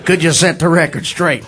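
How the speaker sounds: low put-on fan voice; cool guy. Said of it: "guy walks over real cool".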